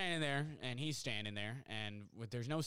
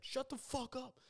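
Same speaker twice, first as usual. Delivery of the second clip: very high-pitched mock outburst; imitated agitated retort; 13 semitones higher than usual. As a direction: play the worked-up dude without raising the volume. Drug user snaps during quarrel.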